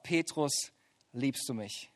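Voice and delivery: Jesus asking Peter a third time, hushed; soft tender question. Acted. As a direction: let the question hang quietly.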